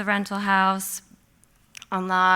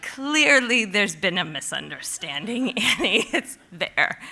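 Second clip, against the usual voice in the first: laughing disbelief; 4 dB louder than usual. Retold or imitated. Opening the empty fridge again, laughing in disbelief. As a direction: talk through nervous laughter. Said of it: "'Cause I'm like".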